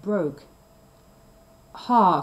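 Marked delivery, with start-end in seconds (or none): 1.9-2.2 loud, sudden exclamation; much louder, pitch barely raised; triumphant cry. A narrator's reading. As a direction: burst out loudly at a beaten foe. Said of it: "cried Sir Pellinore".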